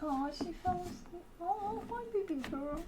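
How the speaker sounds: soft, high-pitched cooing; tiny, tender high voice. Real, not acted. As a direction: coo quietly and sweetly to a sleepy pet. Cooing at her sleeping pet.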